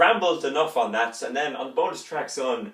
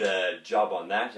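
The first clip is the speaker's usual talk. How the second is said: normal talking voice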